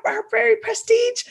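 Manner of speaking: normal talking voice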